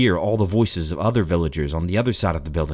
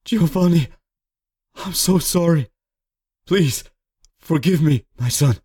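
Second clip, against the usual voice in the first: higher-pitched, anguished father; pleading apology; 9 semitones higher, 3 dB louder than usual. A narrator's reading. Father caught stealing apologizes to his son, anguished pleading. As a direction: beg your son to forgive you. Ashamed father begs son's forgiveness.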